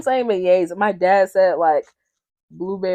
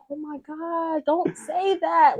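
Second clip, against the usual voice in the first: slow dismay; 8 semitones higher, slower than usual. Retold or imitated. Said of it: "I was so upset".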